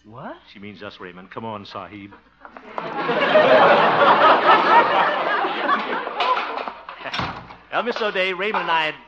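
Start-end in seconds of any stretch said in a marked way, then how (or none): 2.4-7.7 audience laughter. Real, not acted.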